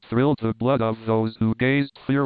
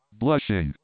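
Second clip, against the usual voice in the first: plain reading voice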